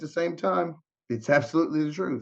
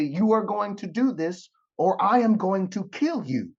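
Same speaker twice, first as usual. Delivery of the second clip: stern ultimatum; 6 semitones higher than usual. Retold or imitated. Retells God's stern ultimatum.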